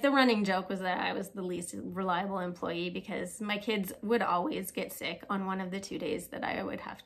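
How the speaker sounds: ordinary talk, nothing marked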